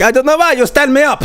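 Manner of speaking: put-on high-pitched, protesting foreign accent; Hamzat impression. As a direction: complain in a put-on, heavily accented English. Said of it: "Hamzat was like".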